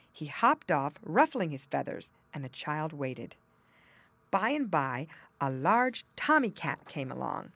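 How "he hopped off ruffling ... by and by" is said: usual speaking voice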